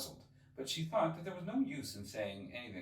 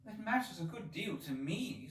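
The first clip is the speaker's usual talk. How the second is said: hasty; 10 semitones higher than usual. Acted. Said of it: "said Alice hastily".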